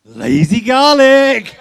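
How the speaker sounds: loud, high, drawn-out exclamation; big reveal. Real, not acted. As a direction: draw it out slowly and loudly, rising in pitch. Revealing an item from hat.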